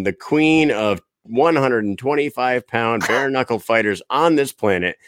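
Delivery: usual speaking voice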